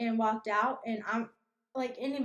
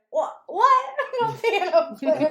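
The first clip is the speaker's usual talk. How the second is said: high, loud, laughing what?; retold disbelief; 6 semitones higher, 6 dB louder, slower than usual. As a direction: play the shock at being asked for a piano player. Shocked at no piano player.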